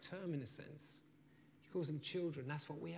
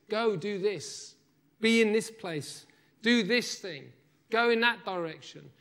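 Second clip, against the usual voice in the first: higher and louder, firm orders; preacher voicing Jesus's commands, loud and firm; commanding voice of Jesus; 5 semitones higher, 5 dB louder than usual. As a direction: bark out the commands like a master giving orders. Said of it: "It's when Jesus says".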